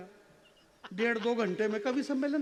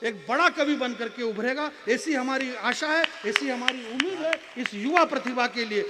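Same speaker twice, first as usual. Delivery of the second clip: loud, emphatic stage oratory; rousing endorsement; 3 semitones higher, 6 dB louder than usual. Real, not acted. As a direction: proclaim it proudly to the whole hall. Champions young poet to the hall. (जोश से, ऊँची आवाज़ में दावा)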